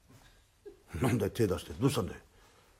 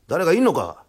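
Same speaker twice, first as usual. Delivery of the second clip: louder, raised call into a house; 呼びかけ; 4 semitones higher, 8 dB louder than usual. Acted. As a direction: call out to see if anyone is in. 呼びかける泥棒. (大きめに呼びかける)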